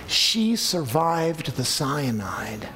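hushed, slow whisper; whispered revelation; dramatic whisper. Acted. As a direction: drop to a whisper for the twist. Shocking twist in a story.

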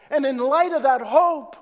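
loud, high preaching call; full-force preaching. Acted. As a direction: preach it out to the congregation.

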